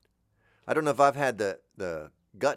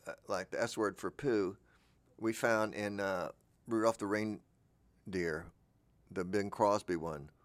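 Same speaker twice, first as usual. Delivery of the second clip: usual speaking voice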